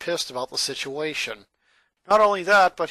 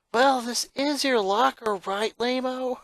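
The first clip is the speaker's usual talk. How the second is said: tomboy voice; 8 semitones higher than usual. A narrator's reading. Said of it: "the tomboy answered".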